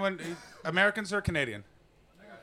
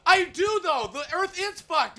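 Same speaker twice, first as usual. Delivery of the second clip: much higher, louder mock protest; squeaky protest; 12 semitones higher, 4 dB louder than usual. Arguing with Al Gore, high and defensive. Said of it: "And it's like".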